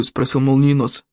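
usual speaking voice

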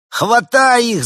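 loud, high yelled order; yelling. A narrator's reading. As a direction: scream the order to grab them. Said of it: "завопил подросток".

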